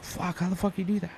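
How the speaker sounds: high, soft amazed aside; amazed mutter. Retold or imitated. Young worker marveling at the lazy painter, soft and high. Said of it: "So I was always like".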